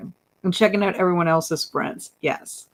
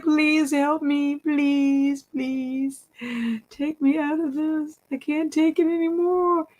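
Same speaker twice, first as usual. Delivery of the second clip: high, small begging voice; pleading groundhog; 9 semitones higher, slower than usual. Pretend. Voicing a groundhog in a picture begging to be freed, high pitched. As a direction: plead like a trapped little animal. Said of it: "they look like they like".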